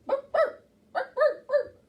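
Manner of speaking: high, loud dog barks; barking for the children. Acted. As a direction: bark like a playful dog. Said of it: "what noise do they make".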